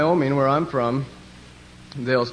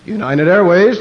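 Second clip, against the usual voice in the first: loud, higher mock announcer's call; airport announcer; 4 semitones higher, 7 dB louder than usual. Announcing planes at the station, mock announcer voice. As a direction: project it like a gate announcement. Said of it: "listening to them announce the planes".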